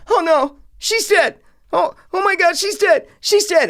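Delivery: high, loud hammed-up panic; mock panicked characters. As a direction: parody the overacted horror reaction. Mocking characters' reaction.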